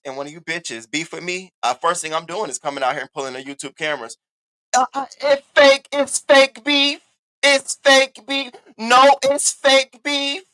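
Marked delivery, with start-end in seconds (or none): 4.8-10.4 loud, slow, high sing-song taunt; drawn-out taunt. Real, not acted. To rival women on her live, a loud slow mocking chant. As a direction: stretch out "fake beef" like a playground chant.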